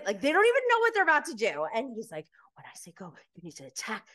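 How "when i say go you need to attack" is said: very soft, intent command; imitated whispering. Instructor readying recruits to attack the staff, whispering. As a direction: give the order hushed, close to their ears. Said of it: "he's, like, whispering to them".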